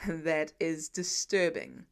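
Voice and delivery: low, slow, flat; flat deadpan. A narrator's reading. Tony judging the elf doll, low and flat. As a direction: say it dead flat. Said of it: "Tony says flatly".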